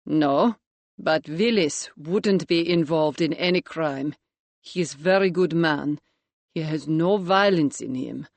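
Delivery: a hint of a Latvian accent, careful and guarded; young Latvian chef defending his friend, light Eastern European accent. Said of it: "Georg spoke with a pronounced Eastern European accent".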